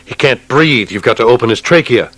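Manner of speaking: officer shouting at orderly over a dying lieutenant; urgent shout. A narrator's reading. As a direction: yell at the orderly to act now. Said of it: "Shilko shouted".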